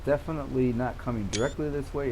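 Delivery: ordinary delivery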